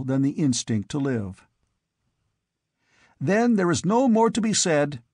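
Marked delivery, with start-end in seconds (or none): 3.2-5.0 higher, clipped impatience; impatient exclamation. A narrator's reading. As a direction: cut the talk short, fed up. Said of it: "exclaimed one of the others impatiently".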